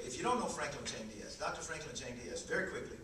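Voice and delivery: ordinary talk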